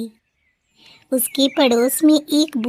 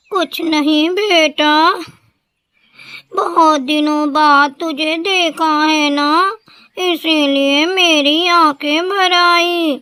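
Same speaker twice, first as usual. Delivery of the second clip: higher, slow, gentle voice hiding tears; tender old mother; 4 semitones higher, 4 dB louder than usual. A narrator's reading. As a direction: brush it off lovingly for your son. Mother hides her pain. (बूढ़ी माँ की धीमी, ममता भरी आवाज़)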